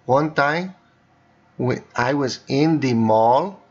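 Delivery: dictating in a Castilian Spanish accent. Shown as a demonstration. Teacher dictates to voice typing in a Castilian accent. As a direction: speak each word in a Castilian accent for the voice typing. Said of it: "that was a Castilian accent".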